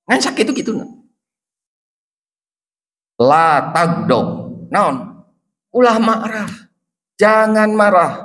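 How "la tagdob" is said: slow and deliberate, a touch lower; drawn-out solemn Arabic; slow solemn quote. Retold or imitated. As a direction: repeat the command slowly and gravely. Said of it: "dijawab ke Rasulullah".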